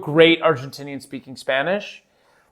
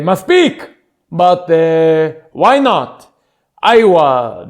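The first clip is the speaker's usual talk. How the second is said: Israeli exclamation; 7 dB louder, much slower than usual. Shown as a demonstration. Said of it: "you need to say".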